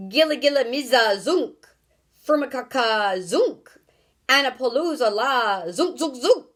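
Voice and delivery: loud, fierce nonsense chant; wild fearsome yell. A narrator's reading. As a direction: yell the spell wildly to frighten the world. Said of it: "in a wild, fearsome voice, he yelled out".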